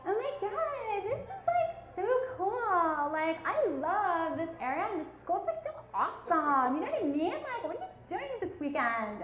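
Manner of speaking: put-on high, gushing rich-girl voice with a giggle; preppy suburban girls. South Philly kid mimics the rich classmates, bubbly and high. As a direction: gush like a bubbly prep-school girl. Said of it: "and they were like".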